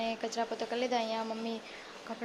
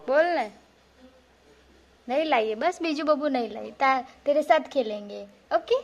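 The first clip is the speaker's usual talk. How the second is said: loud coaxing; 2 semitones higher, 8 dB louder than usual. Real, not acted. Mother coaxing her sulking son to speak, loud and pleading.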